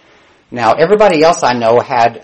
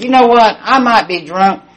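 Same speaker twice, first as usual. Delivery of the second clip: acted drunken comeback; 9 semitones higher, 4 dB louder than usual. Drunk roommate answering the caller's insult, indignant comeback. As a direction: play a drunk roommate hitting back.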